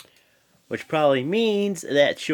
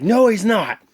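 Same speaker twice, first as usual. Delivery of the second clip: mock loud retort; 9 semitones higher, 8 dB louder than usual. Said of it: "And Mick's like".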